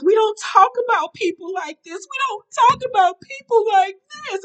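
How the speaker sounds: high, whiny mock-crying voice; Kaylin. Crying woman protesting while being hugged, mocked in a high wail. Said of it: "And Kaylin says".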